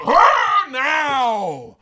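a screamed, very high charge command; battle cry. Retold or imitated. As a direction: unleash the attack at full voice. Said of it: "And he says".

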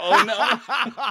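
loud, high laughing groan; laughing protest. Real, not acted. Host begged not to air grievances, laughing protest.

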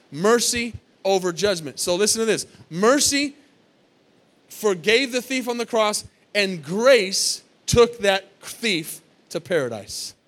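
loud, slow, punched preaching; loud pulpit preaching with hammered words; preaching at full force. Acted. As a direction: drive each phrase home to the congregation. Preaching climax with loud bursts.

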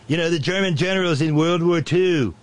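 high, loud caricature of a boss; Nitwit Nero. Acted. Boss explaining to chief of staff, high loud caricature. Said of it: "Nitwit Nero looked at him and said".

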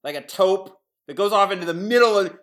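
ordinary talk

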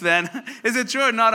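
normal talking voice

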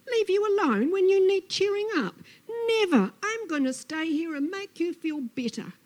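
loud, high lizard outrage; indignant Lizard. Acted. Lizard refuses to leave Snake alone, indignantly. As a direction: refuse at full high pitch, determined to help.

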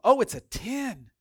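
high, loud, drawn-out put-on cheer; mock gushing. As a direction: imitate a couple overselling their happiness. Mimicking couple's boast about marriage.

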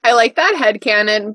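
ordinary talk, nothing marked

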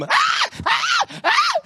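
shrill imitated screams, very high; hammed-up high-pitched shrieks; mock scream. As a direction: squeal like a scared child at a lizard. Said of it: "only they scream".

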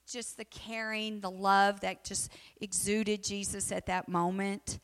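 ordinary talk

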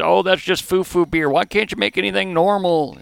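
mock Southern twang for the bar critics; bad Southern accent. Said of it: "adopt some sort of bad Southern accent".

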